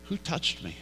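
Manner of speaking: very soft, after a sudden stop; acted hushed, measured question. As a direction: ask it quietly into the silence. Jesus stops, asks the crowd.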